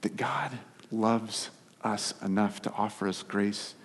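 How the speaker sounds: slow hushed whisper. Acted. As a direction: lean in and whisper it. Preacher whispers key line.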